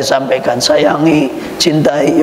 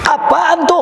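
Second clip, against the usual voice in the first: high, sharp mock complaint; complaining husband; 10 semitones higher, slower than usual. Husband griping at his wife's coffee, mock high complaint.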